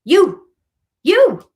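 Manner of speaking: high, loud, punched "You"; emphatic answer. Real, not acted. Reader telling the client she alone suffers, loud and high. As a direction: drive the point home twice. Answering her own question forcefully.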